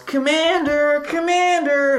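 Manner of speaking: high, drawn-out mock wail; tearful yell. Beaten bully running from the gym calling for Commander, tearful mock yell. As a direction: call out on long, high held notes. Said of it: "wiping back tears as he yells".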